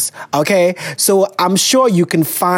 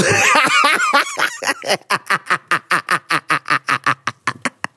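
burst of laughter; 2 semitones higher, 3 dB quieter than usual. Real, not acted. Reacting to listener letter.

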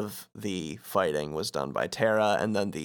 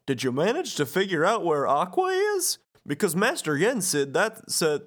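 higher, sing-song goofball; Goofy; 8 semitones higher than usual. Acted. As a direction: bumble through it as the cartoon goofball. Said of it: "Goofy says".

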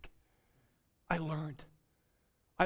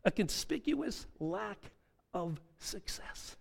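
soft slow reading; 4 semitones higher, 7 dB quieter, slower than usual. Shown as a demonstration. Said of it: "Winston Churchill's report card".

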